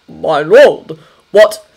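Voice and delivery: startled butler. Acted.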